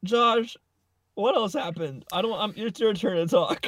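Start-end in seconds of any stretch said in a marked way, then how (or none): none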